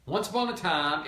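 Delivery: plain reading voice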